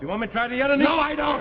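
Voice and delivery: loud, rising protest; indignant refusal. Acted. Husband refuses test on other knee, loud protest. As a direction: raise pitch and volume in alarm.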